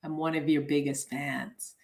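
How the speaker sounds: low, hushed confiding voice; retold whisper. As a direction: lean in softly, as if whispering to a star. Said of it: "I whispered".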